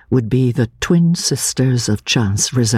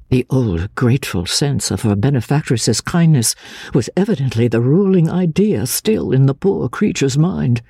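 ordinary delivery

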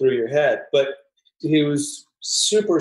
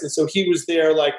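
ordinary talk

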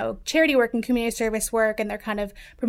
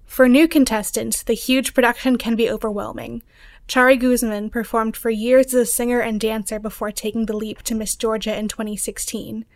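news read; 7 dB louder than usual. Acted. Reporter reads scripted story.

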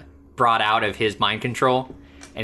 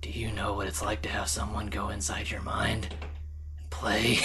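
hammed-up hushed movie whisper; overdramatic Hawkeye; 9 dB quieter than usual. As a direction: whisper it with mock heavy drama. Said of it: "all so over the top and so over dramatic".

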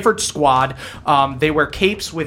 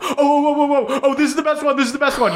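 high, giddy bully reading a diary aloud; mocking reading aloud; 10 semitones higher than usual. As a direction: read it out high and gleeful, barely holding back laughter. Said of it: "reading a bunch of mantras and laughing".

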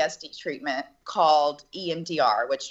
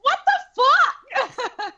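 much higher, slow, louder laughing exclamation; laughing disbelief; 5 dB louder, much slower than usual. Retold or imitated. She reacts to the girls' calm, slow laughing high outburst. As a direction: stretch it out, laughing in shock. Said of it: "And I'm like".

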